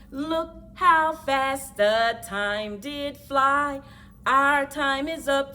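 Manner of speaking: ordinary delivery, nothing marked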